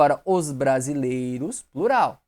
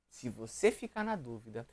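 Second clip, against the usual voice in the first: se você ficar na dúvida repeated lower and softer; 4 semitones lower, 10 dB quieter than usual. Shown as a demonstration. Teacher quietly repeating the rule to students. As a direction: drop se você ficar na dúvida to a soft aside.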